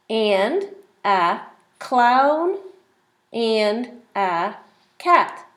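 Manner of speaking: very slow, word by word; slow read-along. Shown as a demonstration. Teacher reading the page slowly with the child. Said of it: "Let's read the page together".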